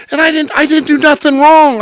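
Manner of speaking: loud high insistence; emphatic protest. Real, not acted. Protesting to the listeners, loud emphatic insistence. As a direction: plead innocence with force.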